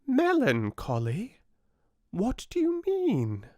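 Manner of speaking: high, piping tiny fly voice; little fly. A narrator's reading. Tiny fly questions the oak, high piping voice. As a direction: squeak it out like a tiny insect. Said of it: "the little creature would always reply".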